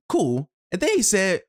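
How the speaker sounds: usual speaking voice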